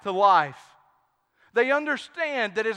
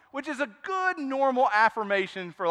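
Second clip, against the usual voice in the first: ordinary delivery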